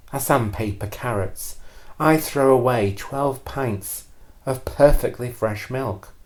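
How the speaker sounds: usual speaking voice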